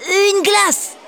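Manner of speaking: put-on greedy boy shouting for ice cream; Théo's eager suggestion. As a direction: blurt out the treat. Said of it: "suggère Théo".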